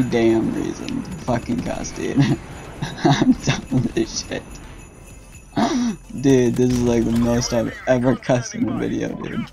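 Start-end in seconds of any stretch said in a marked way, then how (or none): 5.6-6.2 sharp high gasp. Real, not acted. Sudden reaction mid-game.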